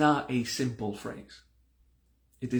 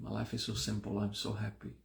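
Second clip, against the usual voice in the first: softer, relaxed mantra repeat; relaxed repetition; 3 semitones lower, 9 dB quieter, faster than usual. Shown as a demonstration. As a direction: let go and softly repeat it. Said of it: "Then you start to relax".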